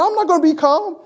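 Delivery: high, playful refusal to calm down; hammed-up indignant protest; teasing mock fury; mock outrage. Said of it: "that's mock outrage".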